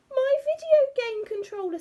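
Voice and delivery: high, loud, distressed cry; very upset girl. A narrator's reading. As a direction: wail it like a heartbroken child. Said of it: "sounding very upset".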